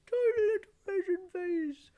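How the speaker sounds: very high, squeezed and small; tiny pinched high voice; raisin face voice. Shown as a demonstration. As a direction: scrunch the face and squeak it out.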